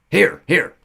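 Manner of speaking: loud, high-pitched announcing; said loudly. A narrator's reading. Centaur announcing the child's trail to Nico, loud. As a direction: call his find out loud. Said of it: "said Amphithemis loudly".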